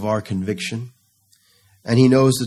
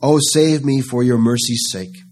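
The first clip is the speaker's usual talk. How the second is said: preacher voicing David begging God for mercy; 3 semitones higher, 4 dB louder than usual. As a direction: beg the Lord to return and save. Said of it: "David begging for a return".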